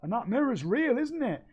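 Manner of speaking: high put-on voice, mildly put out; annoyed character voice. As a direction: play the grumpy little character. Character asks.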